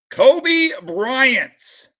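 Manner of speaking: pulling a big card, voice jumps up and loud; excited. Real, not acted. Collector spotting a Kobe card, calls it out excitedly. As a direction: call out the name like a prize.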